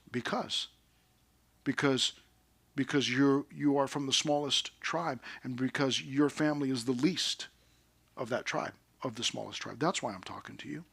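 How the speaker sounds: low and soft, fading away; quiet, measured answer. Retold or imitated. Voicing the quiet answer to Saul.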